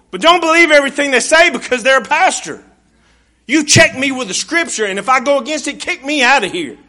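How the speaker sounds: loud, high, fervent preaching; full-force pulpit warning. Acted. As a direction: drive it home to the congregation. Preacher turns to congregation.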